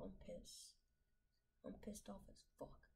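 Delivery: hushed, seething curse; muttered fury at the game; whispered anger. Real, not acted. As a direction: spit it out under your breath. Angry whisper while losing.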